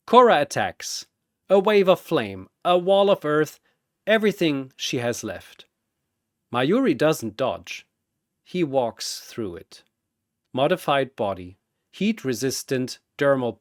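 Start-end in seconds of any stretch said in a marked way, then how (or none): none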